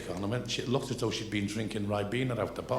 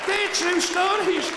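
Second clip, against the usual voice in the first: slow, high, loud laughing protest; laughing insistence; 6 dB louder, slower than usual. Real, not acted.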